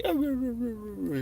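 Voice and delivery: high yowling dog voice, roo roo; dog talking voice. Retold or imitated. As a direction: mimic a dog trying to talk, pitched high. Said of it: "whatever kind of dog voice it has".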